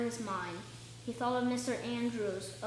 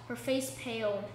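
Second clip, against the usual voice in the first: ordinary delivery, nothing marked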